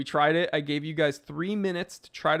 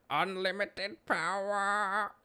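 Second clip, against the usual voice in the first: drawn-out, higher villain voice; mock villain cry; 6 semitones higher, much slower than usual. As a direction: stretch it out like a gloating movie villain. Quotes Star Wars catchphrase after joke.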